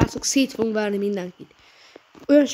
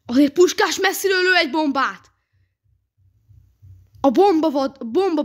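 excited commentary; 5 semitones higher, 4 dB louder than usual. Real, not acted. Gamer commentating own goal.

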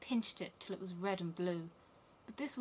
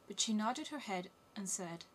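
usual speaking voice

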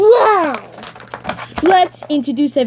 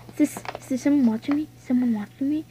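fast, softer nervous question; nervous murmur; 7 dB quieter, faster than usual. Acted. Character scared of being watched, quick soft nervous question. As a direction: check the room anxiously, quick and soft.

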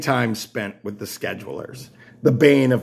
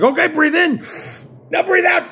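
barked commands. Retold or imitated. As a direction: drill sergeant commands. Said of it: "And she's like".

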